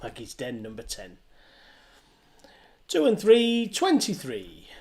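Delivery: sing-song rising then falling number calls; bingo caller. Acted. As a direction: call each number like a bingo hall. Online bingo number calling.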